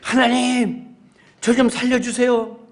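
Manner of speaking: man stuck on a roof in a flood, shouting to God for rescue; yelling for help. Pretend. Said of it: "그래서 더욱 소리 질렀어요".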